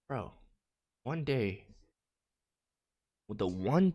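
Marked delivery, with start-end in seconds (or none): none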